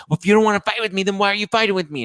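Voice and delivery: high, loud imitation of a quarrel; mock angry yelling. Mother challenging her ex over fighting, hammed-up yelling. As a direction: pick a fight in a shrieky put-on voice.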